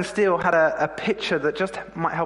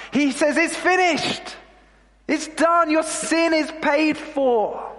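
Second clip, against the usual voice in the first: impassioned preaching; 6 semitones higher than usual. Acted. Preacher proclaiming forgiveness at the cross, fervent and ringing. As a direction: proclaim it to the congregation with rising fire.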